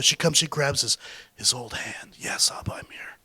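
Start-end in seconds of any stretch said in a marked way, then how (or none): 2.2-3.2 soft unvoiced whisper at the bedside. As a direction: breathe it gently to a dying father. Daughter at dying father's bed.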